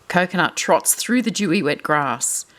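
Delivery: usual speaking voice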